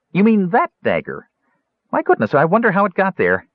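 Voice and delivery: high kender voice, playing innocent; feigned innocence. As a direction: act wide-eyed and innocent about the dagger.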